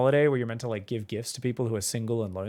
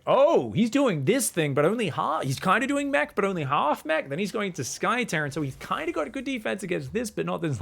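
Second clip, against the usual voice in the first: excited strategizing; 9 semitones higher than usual. Retold or imitated.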